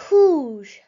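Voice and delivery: rouge, projected for learners to copy; loud French rouge. Shown as a demonstration. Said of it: "For red, you say".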